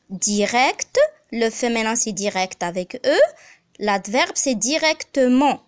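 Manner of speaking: ordinary talk, nothing marked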